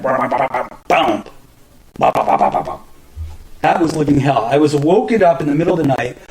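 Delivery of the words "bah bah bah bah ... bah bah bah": rapid "bah" bursts mimicking a quake; imitated quake noise. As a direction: vocalize the rumble and boom of an earthquake. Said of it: "It went".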